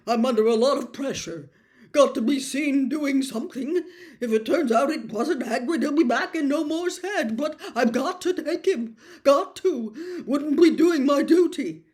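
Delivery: a pressured bureaucrat talking himself into it; flustered minister. Acted. Flustered Fudge justifying.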